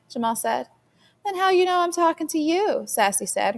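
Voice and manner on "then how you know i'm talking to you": higher, gloating comeback from a little sister; you just won the argument, crow it; triumphant. A narrator's reading. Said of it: "her voice full of triumph".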